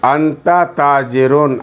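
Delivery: انت تاجرون read slowly, تاجرون stretched; slow model reading. Shown as a demonstration. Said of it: "آپ تاجر ہیں".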